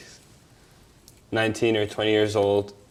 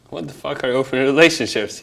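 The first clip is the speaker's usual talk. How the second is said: higher, louder, half-laughing disbelief; incredulous outburst; 5 semitones higher, 6 dB louder than usual. Retold or imitated. New to New York, hearing of open relationships, laughing disbelief. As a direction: blurt it out like you can't believe it exists. I'd be like.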